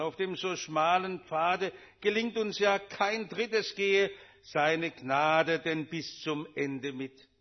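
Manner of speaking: slow, solemn recited hymn verse; hymn recitation. Real, not acted. Preacher reciting Hiller's hymn to the congregation, slow solemn recitation.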